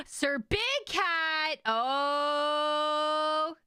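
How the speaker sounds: high, drawn-out mock-shocked greeting; gasp, then stretch the words up high; theatrical surprise at a latecomer; surprised greeting. Real, not acted. Greeting a viewer arriving late.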